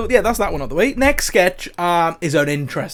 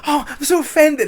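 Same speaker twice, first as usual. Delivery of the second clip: higher, overacted offense; mock offended; 10 semitones higher than usual. Girlfriend overacts being offended, mock hurt. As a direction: ham up the outrage. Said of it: "She's like, overacts so much".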